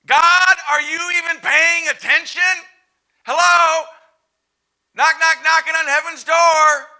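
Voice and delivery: very high, loud desperate cry; desperate Martha. Acted. Martha crying out to God, desperate and loud. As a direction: yell up to heaven for notice. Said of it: "Can you hear Martha's abandonment?".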